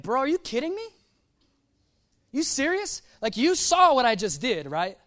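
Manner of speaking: mock exasperated God, pitch jumping up; incredulous upset. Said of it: "I'd be, like, upset".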